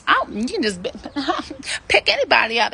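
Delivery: high, laughing mock of a deluded dater; a giggly parody of wishful thinking; mocking naive woman. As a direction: scoff at the naive idea through a laugh. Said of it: "this delusion like".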